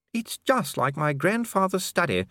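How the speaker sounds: high, slow lady's voice; high-pitched lady recalling home; reminiscing lady. Acted. Voices Lady Sybil in narration.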